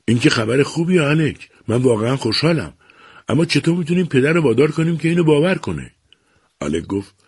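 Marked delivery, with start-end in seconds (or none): 0.1-5.9 higher, brighter happy reply; light up at the good news; glad. A narrator's reading. Daughter answers her brother's reassuring news, gladly. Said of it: "مالی خوشحال گفت".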